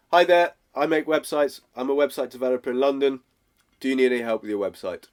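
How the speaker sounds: quick, to-the-point pitch; direct pitch. Shown as a demonstration. Coach demonstrates a direct opening line to a prospect, brisk. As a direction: get straight to it, brisk. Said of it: "It's direct, it's to the point".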